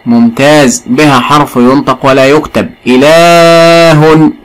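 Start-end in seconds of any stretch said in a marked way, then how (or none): 2.9-4.3 "إله" drawn out very slowly, the hidden long vowel sounded clearly; stretched pronunciation demo. Shown as a demonstration. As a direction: stretch "إله" so the unwritten alif is heard. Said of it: "لاحظ النطق ولكن لا نكتبه". ("إله" ممدودة ببطء شديد لإظهار الألف التي تُنطق ولا تُكتب)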